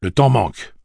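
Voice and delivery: loud, curt orders; brusque. A narrator's reading. Roland pressing his friends, brusque tone. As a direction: bark it out short. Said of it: "parla d'un ton brusque".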